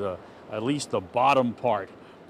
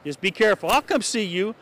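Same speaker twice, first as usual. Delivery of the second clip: raised, higher call across the mud; 7 semitones higher than usual. Real, not acted. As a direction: call out a warning to her from a distance. Reporter calls to neighbor across street.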